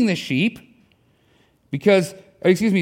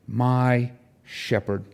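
"my" drawn out slowly, then "shepherd" set apart; stress on MY; 4 semitones lower, much slower than usual. Shown as a demonstration. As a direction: lean long on "my", low and slow. Contrasts "my" with "a shepherd.

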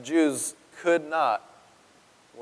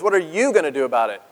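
"you" punched in a quick challenge; quick taunt leaning on YOU; stress on YOU; faster than usual. Acted. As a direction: throw the weight on "you", briskly. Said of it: "they are really emphasizing that the".

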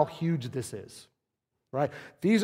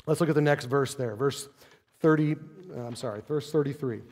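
ordinary talk, nothing marked

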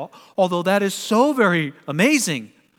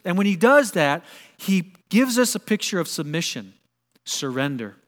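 ordinary talk, nothing marked

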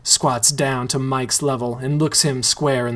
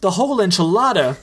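quick, higher disbelieving repeat; fast, higher echo of shock; stunned echo; 6 semitones higher, much faster than usual. Acted. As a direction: throw the phrase back in awe. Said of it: "Richie echoes".

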